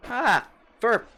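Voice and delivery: sudden high yelp of alarm; startled yelp. Real, not acted. Streamer hits wrong key, yelps in surprise. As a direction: caught off guard, blurt it out loud.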